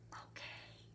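very soft secret whisper. A narrator's reading. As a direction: whisper it so nobody notices. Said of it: "he whispered to Carla".

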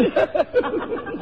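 a high, bright giggle after the gossip. Acted. Mother giggling to the officer about the colonel's wife. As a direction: giggle high at your own joke.

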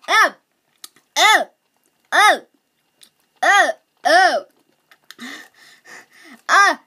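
sharp high yelps at the sourness; sour pain yelps. Real, not acted. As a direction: cry out at each sour hit. Reacting to extreme sour candy.

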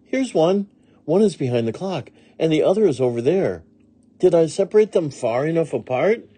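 high-pitched eager maid; Amelia Bedelia answering. A narrator's reading. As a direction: point things out innocently, then ask. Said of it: "asked Amelia Bedelia".

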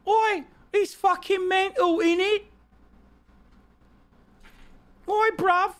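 very high-pitched cartoon British accent; mock British accent. Streamer mocking the nutter brother, high mock British accent. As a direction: squeal it like a caricature British lout. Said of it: "my British fucking accent".

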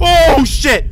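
high, loud startled curse; panicked shout. Real, not acted. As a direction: blurt it in panic.

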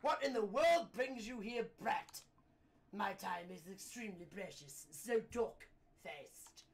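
haughty posh British businessman; loud posh British outburst, then slow and pointed; snooty British gentleman, short on patience; posh British man. Acted. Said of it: "he sounds like a posh British man".

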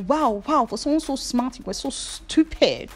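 incredulous. Pretend. Scolding past self.

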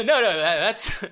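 ordinary talk, nothing marked

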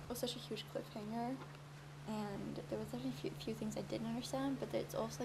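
soft excited voice; quietly gushing over a book. Real, not acted. Just finished the book.

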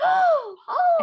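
very high squeaky gasping oh; breathless tiny high cartoon gasps; gasping mouse voice. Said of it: "She gasps a lot".

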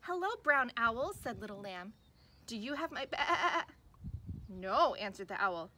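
put-on lively storybook read for little kids, big character voices; reading to children. Teacher reading a picture book to kids, animated storytelling. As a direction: read it like circle time, animated and slow.

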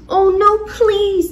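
high, loud panicked plea; frightened pleading. Acted. Ghost begging Miss Knight not to eat them, frightened. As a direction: beg for mercy in alarm.

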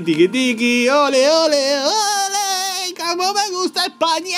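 high, hammed-up flamenco cheer; high mock flamenco voice. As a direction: camp it up with castanets. Mocking Spanish flamenco cheer.

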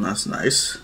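ordinary delivery, nothing marked

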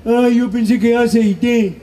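very slow dim excuse with a laugh; mock brother sheepishly defending his mistake; dopey kid. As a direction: play the slow-witted little brother.

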